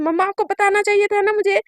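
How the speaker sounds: put-on girl scolding her mother for not telling her, loud and upset; frustrated outburst. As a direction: vent at mom, all that effort wasted. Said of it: "relax, क्या हो गया?".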